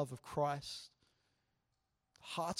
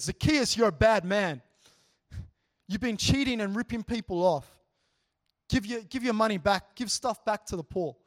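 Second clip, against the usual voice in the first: loud, higher-pitched mock scolding; mock rebuke; 4 semitones higher, 8 dB louder than usual. A preacher imagining Jesus telling Zacchaeus off, loud mock scolding. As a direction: lay into him like an accuser.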